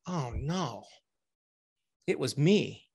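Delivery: soft, sinking "oh no"; retold dismayed realisation. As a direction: let the dread land quietly, as a retelling. Retold dawning recognition of own voice.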